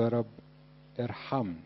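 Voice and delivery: يا رب ارحمني said softly and unhurried; slow and gentle. Shown as a demonstration. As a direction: pray it slowly from the heart. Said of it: "بالراحة كده". (بالراحة وبهدوء)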